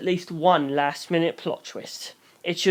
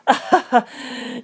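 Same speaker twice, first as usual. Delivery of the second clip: a quick amused laugh, higher; short laugh; 8 semitones higher than usual. Acted. Suku laughs at the boy's question, short amused laugh. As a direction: let a brief laugh slip out.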